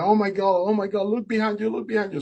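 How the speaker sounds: very high, urgent exclamation, retold; excited alarm. Boatman pointing out the dolphin behind the swimmer, excited alarm.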